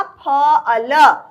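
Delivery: each letter drawn out slowly, one at a time; sounded out slowly. Shown as a demonstration. Teacher sounds out फ अल slowly for children before blending. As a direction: spell फ अल out slowly letter by letter for the class. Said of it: "जोड़ के पढ़ो".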